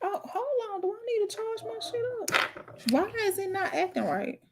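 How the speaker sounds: baffled by the phone; real confusion, high and soft; puzzled. Real, not acted. Device glitch again.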